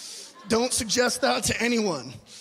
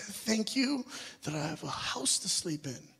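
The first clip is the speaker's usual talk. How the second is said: much softer, a retold tearful whisper of thanks; tearful prayer; 2 semitones lower, 10 dB quieter than usual. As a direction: hush it like recalling crying alone. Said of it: "tears came down my face".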